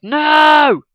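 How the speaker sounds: loud, high shouted no; a class yelling its answer in unison; strong chorus. Acted. Whole class shouting the answer back. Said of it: "was very strong".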